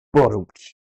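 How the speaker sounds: spoken drum rimshot; rimshot sound. Acted. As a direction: punch the joke with a ba-dum-tsh. Rimshot after dad joke.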